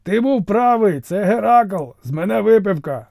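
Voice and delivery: високо, весело кричить; гукає вниз із радістю; радісний оклик. A narrator's reading. Фракієць гукає Ліхаса. (фракієць радісно гукає Ліхасу)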